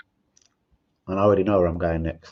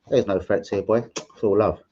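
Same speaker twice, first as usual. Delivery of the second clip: ordinary talk, nothing marked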